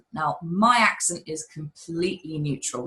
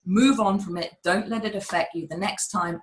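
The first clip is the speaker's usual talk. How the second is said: usual speaking voice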